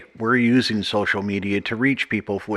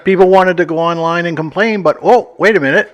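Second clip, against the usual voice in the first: sarcastic; 8 semitones higher, 7 dB louder than usual. Real, not acted. Sarcastic joke about outage.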